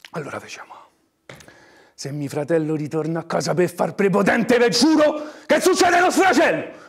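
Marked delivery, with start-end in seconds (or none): 1.9-6.9 high, loud angry cry; furious shout. Acted. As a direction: shout the threat. Threat in a furious outburst.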